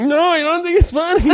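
very high, mock sobbing plea; high-pitched mock blubbering; crying teen. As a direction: play his younger self wailing through tears. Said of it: "I'm like".

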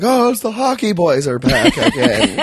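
high, laughing motel clerk voice; cheery greeting. Retold or imitated. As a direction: play the excited clerk. Motel staff greeting them.

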